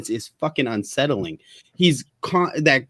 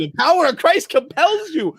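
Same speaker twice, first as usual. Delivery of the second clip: high shouted exorcism command; exorcist shout. Pretend. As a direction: bellow it like an exorcist. Mock exorcist chant.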